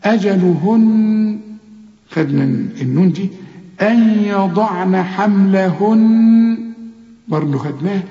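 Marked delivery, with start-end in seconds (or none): 0.0-1.4 chanted slowly; slow recitation. Shown as a demonstration. 3.8-6.7 slow recitation. Shown as a demonstration.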